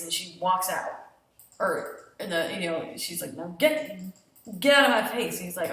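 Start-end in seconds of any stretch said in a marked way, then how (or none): 3.3-5.4 louder angry retold order; angry shout. Faye ordering Harvey out, angry shout. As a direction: bark it at him to leave.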